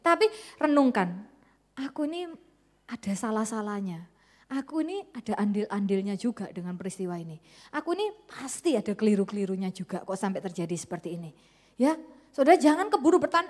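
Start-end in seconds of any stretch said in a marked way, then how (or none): 1.7-11.3 soft, hushed confession to yourself; quiet self-examination. Acted. Preacher voicing a soul's hushed self-reflection. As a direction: murmur it like searching your own conscience.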